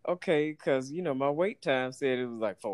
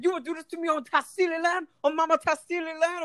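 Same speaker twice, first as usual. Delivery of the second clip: put-on high West Indian accent, preaching about energy. Cook lecturing rude customer, West Indian accent. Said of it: "a West Indian accent".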